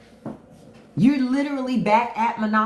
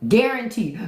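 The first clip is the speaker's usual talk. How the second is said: ordinary delivery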